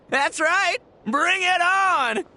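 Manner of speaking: cocky challenge. Acted. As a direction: throw down the gauntlet, slow and proud. Rival accepts the challenge.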